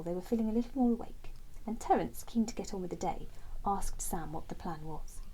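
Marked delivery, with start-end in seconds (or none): none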